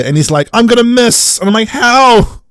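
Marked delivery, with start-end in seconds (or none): 0.5-1.4 high-pitched silly protest; mock warrior whine. Warrior aiming at the kids, high mocking voice. 1.7-2.4 high-pitched exasperated disbelief; incredulous. Acted. Host challenging the warrior who misses, high incredulous protest.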